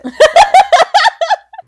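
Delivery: loud high burst of laughter; cackling laugh; big laugh. Real, not acted. Hosts laughing at intro.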